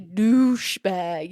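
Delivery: "douchebag" very slow with a long "oosh"; drawn-out oosh. Shown as a demonstration. Showing she's got it, long oosh in "douchebag". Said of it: "You gotta make a".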